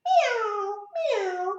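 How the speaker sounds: high drawn-out meows; cat meow. Pretend. Reader showing what cats say, meowing high. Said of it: "Cats say".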